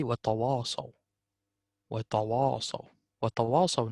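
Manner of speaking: "tawasaw" with dark heavy alifs, "tawwasaw"; wrong tawasaw. Shown as a demonstration. Tutor to student, showing the heavy wrong alif. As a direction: show the darkened "tawasaw" as the mistake. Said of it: "Don't say".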